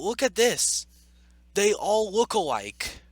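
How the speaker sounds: usual speaking voice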